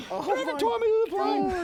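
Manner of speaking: very high, worked-up acted objection; panicked protest. Passenger told he'll be tied to the plane, high protest.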